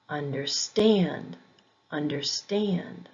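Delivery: understand with STAND stressed; stress on second part. Shown as a demonstration. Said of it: "emphasis on the second syllable".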